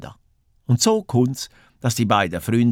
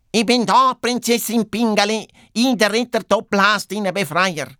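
high hare voice proclaiming the rescue; heroic calling out; 10 semitones higher than usual. A narrator's reading. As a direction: call out as the self-declared rescuer. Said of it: "und ruft". (hoch ausgerufene Retterpose)

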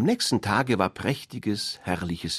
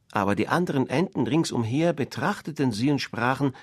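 ordinary delivery, nothing marked

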